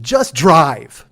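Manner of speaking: loud, punchy mock command; loud order. Passenger hits the driver's shoulder, loud impatient order.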